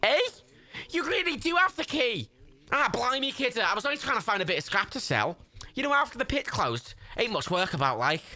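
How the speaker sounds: high, exclaiming Scouse chancer; squeaky cartoon Scouser; Scouse Jimmy. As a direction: protest in thick high-pitched Liverpudlian. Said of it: "Scouse Jimmy".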